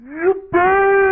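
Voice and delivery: high-pitched cartoon whoop of joy; gleeful cheer. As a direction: cheer it out high, celebrating victory. Celebrates a victory.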